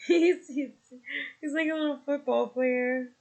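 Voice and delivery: proud giggle through the words; laughing. Real, not acted. Mother laughing about her tough baby boy, laughing.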